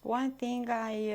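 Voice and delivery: usual speaking voice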